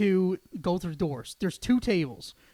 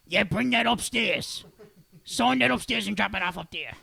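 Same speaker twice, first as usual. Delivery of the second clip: hint of the Monsters Inc secretary, dragged out; a mild impression of the secretary from Monsters, Inc; mimicking the ticket lady. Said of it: "why do you sound like the secretary from Monsters, Inc".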